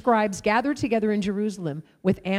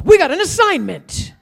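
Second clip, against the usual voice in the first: higher and louder, bursting with eagerness; thrilled announcement; 8 semitones higher, 9 dB louder than usual. Retold or imitated. Boy nudging friends about their assignment, eager and loud. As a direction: tell your buddies the big news.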